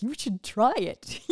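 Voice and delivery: loud teasing dare; hammed-up loud jeer, about to crack up; mocking guy friend. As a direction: imitate a buddy egging you on in ridicule. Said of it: "they're laughing hysterically".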